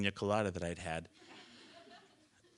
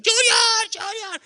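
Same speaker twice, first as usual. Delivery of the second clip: very high shrieked calling, first one loudest; imitated mother's scream; 9 dB louder, slower than usual. Mom on shore calling her falling child, imitated shriek. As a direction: shriek the name as if across water.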